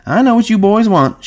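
high-pitched madam impression; Susie. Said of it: "And Susie says".

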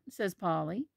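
ordinary delivery

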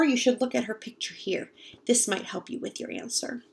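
ordinary delivery

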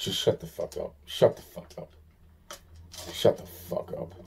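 fed-up whisper to himself; harsh whisper. Real, not acted. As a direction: hiss it under your breath. Repeated whispered outburst.

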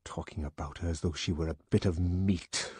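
contained, bitter inner anger; tight, resentful brooding; seething. A narrator's reading. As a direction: spit it out under restraint. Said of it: "Bernard ground his teeth".

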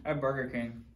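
chewing while talking; laughing with food in mouth; mouth full of fries. Real, not acted. Seen 1: both men laugh and bite fries; unclear which one speaks.

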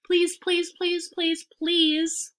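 high-pitched chant of pleading goslings; pestering goslings. A narrator's reading. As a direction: pester mother goose with insistent pleas. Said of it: "all seven began teasing to go".

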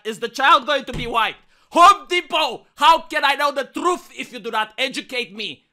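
loud indignant rant; hammy stage actor. Acted. Character rant at Home Depot.

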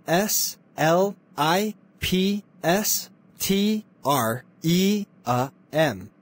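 slow, letter by letter; careful classroom spelling, one letter at a time. Acted. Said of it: "how do you spell slipstream?".

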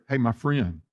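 a preacher showing how tender the word son is, soft and friendly; tender greeting. Shown as a demonstration. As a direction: greet him gently like an old friend. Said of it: "the word son there is a very tender word".

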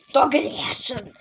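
ordinary delivery

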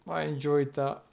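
normal talking voice

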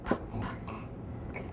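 breathy whispered p sound. Shown as a demonstration. Teacher whispers letter sounds to young students. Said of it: "saying letter sounds of tap".

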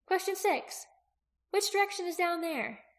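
put-on high girl's voice, posing a puzzle; questioning young Aki. Aki listing open questions for Jaqen, high questioning voice.

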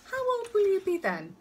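high, loud coaxing question; sing-song lift, talking to a little one; parent to toddler. A narrator's reading. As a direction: quiz a small child, pitched right up. Father asks toddler her age.

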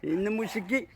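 ordinary talk, nothing marked

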